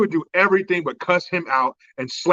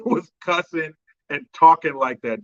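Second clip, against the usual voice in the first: normal talking voice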